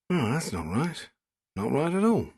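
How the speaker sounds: light lilting worry over the console; the Doctor, puzzled. Acted. Doctor fretting over a flashing console light. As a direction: frown at the flashing light and fret.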